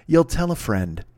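usual speaking voice

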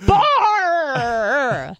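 laughing as she drops the R on Bar; a laughing Aussie Bar with no R; mock Aussie bar, laughing. Mocking Aussie friends, laughing through the word. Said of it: "why don't you pronounce R's".

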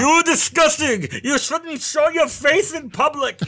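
very high, loud, drawn-out outraged scolding; Larry David impression. Co-host imitating a comedian publicly berating a lawyer, outraged high yell. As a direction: scold slowly at a high-pitched yell, impersonating someone. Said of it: "Pretty good impression".

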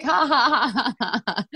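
drawn-out fake laugh, high; mock slow laugh. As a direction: laugh it off slowly, teasing. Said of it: "I was like".

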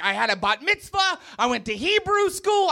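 mock indignant protest, climbing high; angry girlfriend. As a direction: imitate an offended girlfriend insisting. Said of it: "She got very angry with me".

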